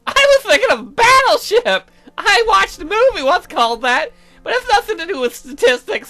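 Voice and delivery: put-on very high, loud girly squeal; excitable game girl. Voices Aseline in game dialogue.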